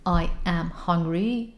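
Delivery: slow, separate words. Shown as a demonstration. Teacher showing the slow wrong way. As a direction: spell out each word slowly. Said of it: "نمیگن دیگه".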